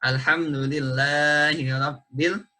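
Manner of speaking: "alhamdulillahi rabbil" with the lillahi vowel stretched too long; overlong mad. Shown as a demonstration. Teacher demonstrating the wrong overlong reading to students. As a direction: drag the mad in "alhamdulillahi" past two beats. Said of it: "Apabila kita baca lebih".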